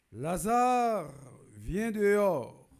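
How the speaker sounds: high, loud call with drawn-out words; loud cry. A narrator's reading. Priest reading Jesus calling Lazarus out, loud cry. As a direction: call out in a strong, commanding voice. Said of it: "il cria d'une voix forte".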